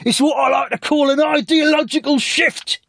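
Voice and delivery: very high, slow put-on voice. [name] warns [name] off the loo in a squeaky voice.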